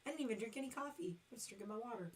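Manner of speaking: ordinary talk, nothing marked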